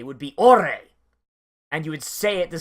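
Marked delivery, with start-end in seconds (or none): 0.4-0.8 loud, angry-sounding demo; forceful shout with heavy emphasis. Shown as a demonstration. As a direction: punch the word hard. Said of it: "You'd be, like, very forceful".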